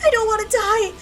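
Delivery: high, loud, crying cry for help; desperate shout. Acted. Child shouts that Doctor Takano will save him, desperate. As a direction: scream your faith in rescue.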